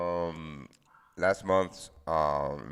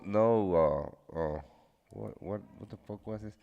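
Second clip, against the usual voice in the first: ordinary talk, nothing marked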